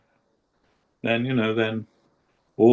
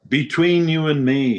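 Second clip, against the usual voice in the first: raised, earnest plea to the Lord; pleading prayer to Krishna; 8 semitones higher than usual. Retold or imitated. Guru addressing Krishna with a raised, pleading voice. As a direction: beg the Lord, voice lifted high. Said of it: "he said, he's talking to Krishna".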